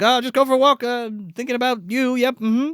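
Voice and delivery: high, overly casual mock innocence; acted awkward chirp; nervous cover-up. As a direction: imitate someone hiding what he did. Corky covers up to Ann-Margret.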